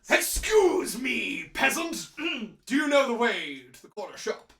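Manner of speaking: mock villain. Villain accosting a peasant for directions, mock imperious. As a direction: start big and drawn out, then ease off. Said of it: "Nothing more villainous than asking for directions".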